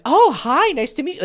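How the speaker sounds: imitating a delighted high-pitched greeting; smitten girl. Coach mimicking a girl who liked his client, charmed. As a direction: lift the voice, sweetly interested. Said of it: "could totally hear it in her voice".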